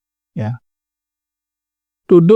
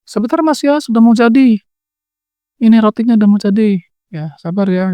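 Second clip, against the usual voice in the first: higher-pitched, lightly voiced wife calling out; istri yang tenang; 7 semitones higher than usual. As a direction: call to your waiting husband, pitched up. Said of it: "Istrinya tetap masak dengan tenang". (suara istri memanggil suami, nada tinggi)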